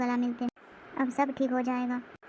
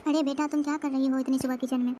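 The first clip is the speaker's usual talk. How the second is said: ordinary delivery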